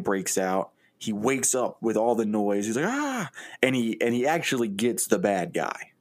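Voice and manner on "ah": startled yelp. Acted. Detective jolts awake in gunfight.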